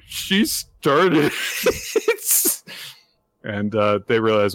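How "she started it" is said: high, slow, through giggles; two girls blaming each other, laughing; laughing while quoting. Retold or imitated. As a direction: laugh out a sheepish excuse. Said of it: "they're like".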